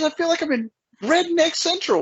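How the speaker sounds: lightly mimicked girlfriend, pitched well up; amazed girlfriend. Girlfriend from Atlanta reacting to small-town greetings, mock amazement. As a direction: imitate her high-pitched mild astonishment. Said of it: "she goes".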